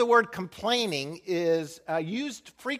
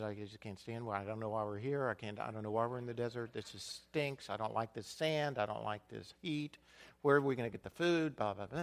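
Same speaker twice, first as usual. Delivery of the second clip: low muttered grumbling, much softer; grumbling under the breath; 6 semitones lower, 9 dB quieter, faster than usual. Retold or imitated. Pastor mimics Israelites grumbling in the desert, muttered under the breath. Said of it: "Kinda like under the breath".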